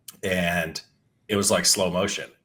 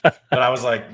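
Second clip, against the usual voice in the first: laughing; 4 semitones higher, 6 dB louder than usual. Real, not acted. Still laughing.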